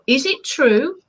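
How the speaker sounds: ordinary talk, nothing marked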